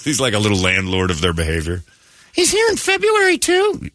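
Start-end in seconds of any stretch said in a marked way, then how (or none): 2.3-3.9 very high, hammed-up kid whine; dismayed child. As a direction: complain like a horrified little kid. Dismayed child sees the elf.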